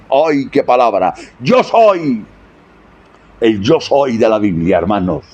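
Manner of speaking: normal talking voice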